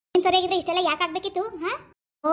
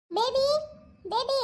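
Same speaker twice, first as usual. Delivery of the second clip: mock sweet calling; 7 semitones higher, much slower than usual. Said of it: "ದೊಂದ್ ಚೆನ್ನಾಗ್ ಕರೀತಾಳೆ".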